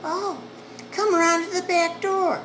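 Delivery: high, loud woman asking through the door; second woman's voice. Retold or imitated. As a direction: check who sent him, suspicious. Woman lets him in.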